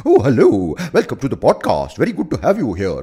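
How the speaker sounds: loud, swooping, hammed-up welcome; joke "normal" host voice. Greeting a guest to the podcast, absurdly effusive host. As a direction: swing the pitch up and down theatrically. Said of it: "I will talk the way I talk normally".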